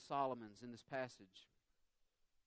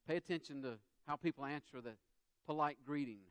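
ordinary talk

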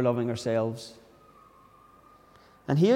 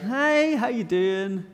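much higher, bright put-on friendliness; fake cheerful greeting; 7 semitones higher, much slower than usual. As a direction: mask the stress with a cheery hello. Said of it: "outwardly you're like".

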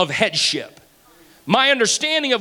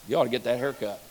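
hushed inner voice; 6 semitones lower, 6 dB quieter, faster than usual. Retold or imitated. Inner voice of Holy Ghost.

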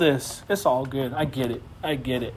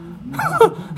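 squealing laugh; real laugh at his own joke; high burst of laughter. Real, not acted.

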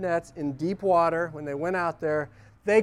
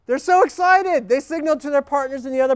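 excited; 6 semitones higher, 7 dB louder, faster than usual. Real, not acted. Fishermen's excitement.

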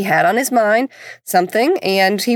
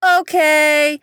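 put-on kid's okay; 7 semitones higher than usual. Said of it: "He says".

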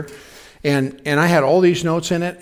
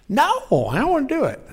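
put-on whiny child refusal; 11 semitones higher than usual.

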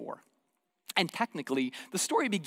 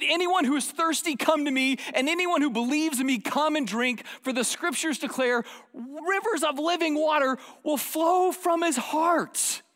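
shouted proclamation; 9 semitones higher than usual. Retold or imitated. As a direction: cry it out to the whole festival, slowing at the end. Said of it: "Jesus shouted as he stood to the crowd".

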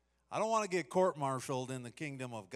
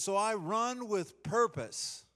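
slow, lifted reading of the verse; slow scripture reading; 6 semitones higher, 3 dB louder, slower than usual. Shown as a demonstration. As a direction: read the verse with weight. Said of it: "It says".

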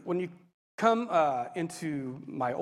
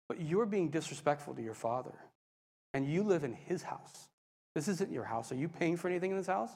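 softer, measured reproof; gentle correction; 6 dB quieter than usual. Retold or imitated. God to teen about respecting his father, quiet correction. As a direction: voice God quietly reasoning with you.

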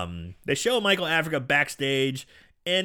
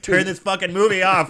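loud, raised-pitch mock complaint; mock irritated yell; 5 semitones higher, 6 dB louder than usual. Daughter woken by loud movie, mock irritated yell. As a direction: yell it as an exasperated outburst.

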